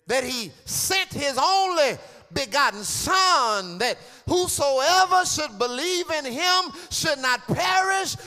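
loud, rising preacher cadence; fiery preaching. Acted. Preacher proclaiming salvation to the church, fiery. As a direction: preach it out with fire.

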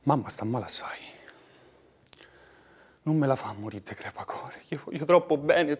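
urgent unvoiced plea; whisper.

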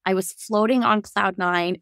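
usual speaking voice

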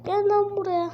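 shout. Acted. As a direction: yell it at him. Hero yells at enemy.

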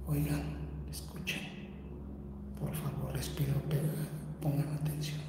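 tense whisper in a haunted house; barely voiced, careful not to alert anything; hushed whisper. Real, not acted. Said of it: "no les voy a hablar más fuerte".

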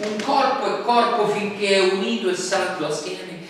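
ordinary talk, nothing marked

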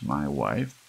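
ordinary delivery

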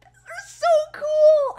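loud, high, drawn-out squeal; thrilled squeal. Real, not acted. Fan holding sparkly new pins, thrilled squeal. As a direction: squeal slowly, bursting with delight.

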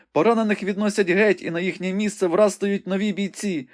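high, urgent maiden reporting a battle; young woman Rebecca, breathless and excited. A narrator's reading. Rebecca calls the battle out to the wounded Ivanhoe, high and urgent. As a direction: describe the fight in a high, eager voice.

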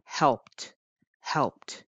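helped with a crisp T ending. Shown as a demonstration. Teacher models the T ending for students, clearly. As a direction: finish helped on a short T. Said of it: "the third sound ... is a T sound".

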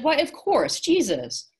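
very high, delighted exclamation; excited realization. A narrator's reading. Boy realizes who the fighter is, excited. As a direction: exclaim as it dawns on you.

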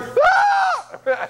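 piercing high wail, shouted; kid crying out. Retold or imitated. Preacher imitating a crying daycare kid, high shouted wail. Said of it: "This kid's going".

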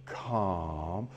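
very low, soft, drawn-out word; low soothing calm. Shown as a demonstration. Trainer soothing his dog, very low and soft. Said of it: "giving him the calm".

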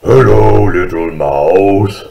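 big, loud, slow bear growl; growling huge bear. Acted. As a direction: growl it out deep and drawn out. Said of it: "growled Huge Bear".

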